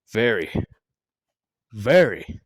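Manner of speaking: drawn-out dramatic pauses; mock-ominous build to a punchline; suspenseful repetition. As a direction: let each word hang alone. Dramatic repeats before a punchline.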